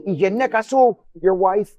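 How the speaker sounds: imitating a worked-up driver, high and loud; all excited. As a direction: fling the accusation out, loud and high. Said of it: "he gets all excited".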